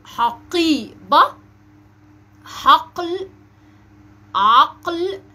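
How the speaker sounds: slow, loud q inside "حقيبة، حقل، عقل"; emphatic middle qaf. Shown as a demonstration. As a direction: model "حقيبة" broken at the heavy q. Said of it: "ده حرف القاف في النص".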